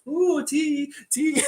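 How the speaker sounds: very high cooing imitation; playful tea mimicry. Imitating two buddies sipping tea, playful falsetto. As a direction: coo it in a silly high voice.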